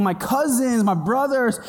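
ordinary talk

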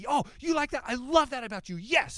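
high, eager, bubbly voice of a yes man; enthusiastic yes man. Retold or imitated. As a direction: gush like an overexcited optimist. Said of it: "He's like".

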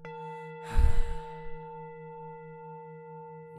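long audible breath out through the mouth; loud exhale. Shown as a demonstration. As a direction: blow the breath out hard. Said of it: "Exhala fuerte por la boca". (exhalación fuerte por la boca)

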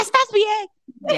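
high, very slow worried question; drawn-out anxious check; mock worried mom. Mother asking her daughter, high slow worried question.

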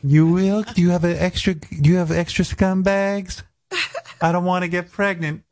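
put-on high falsetto girl voice; boy faking a girl on the phone. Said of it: "I'd be doing a girl voice".

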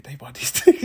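breathy laugh mid-line. Retold or imitated. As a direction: laugh through the words. Laughing at the sales.

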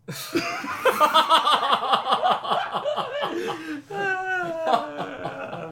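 high shrieking laughter. Real, not acted. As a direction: crack up hard, almost crying. Hysterical laughter after punchline.

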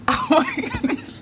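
high giggling "oh my goodness"; laughing. Real, not acted. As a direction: laugh at own fumble. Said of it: "I'm getting tongue-tied".

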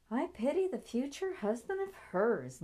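high, lilting amused thought. A narrator's reading. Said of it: "Nancy thought with a chuckle".

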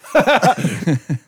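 high, sudden laugh; re-enacted burst of laughter. Father and son at the nurse's question, re-enacted shared laugh. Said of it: "Cade and I at the same time go".

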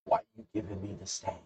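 quick, soft asking; offhand acted question. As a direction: toss it out quietly and briskly. First take of acted question.

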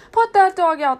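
loud, high shouted order; mock scolding. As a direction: shout like an annoyed owner, mimicking. Tramp mimics scolding humans.

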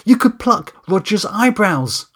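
high, loud, slow and deliberate pitch; eager brainwave. Acted. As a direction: spell out your brilliant idea with excitement. Excitedly pitching his sudden idea.

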